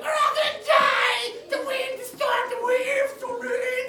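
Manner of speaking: high, loud, slow mock panic; panicked disciples. Teacher acting panicked disciples in the storm, hammed-up terror. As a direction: wail it like sailors sure they'll drown. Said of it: "the disciples are like".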